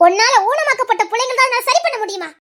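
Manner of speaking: usual speaking voice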